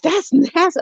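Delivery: high, disgusted man's voice; husband's disgusted quote. Retold or imitated. Husband in the car reacting to the smell, high and disgusted. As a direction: squeal it in mock disgust. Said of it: "he was just like".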